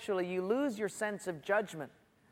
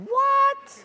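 very high, loud squawk of disbelief; comic high-pitched outburst; incredulous; 9 dB louder than usual. Acted. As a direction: shriek it like you can't believe your ears. Mock disbelief at Delilah's words.

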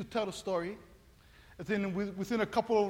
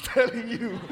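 ordinary delivery

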